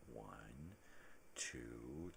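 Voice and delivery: laborious typing. Acted. Acts slow typewriter typing.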